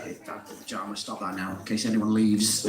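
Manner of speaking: hushed under-the-breath impression of a colleague; imitating Steve. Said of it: "He's like".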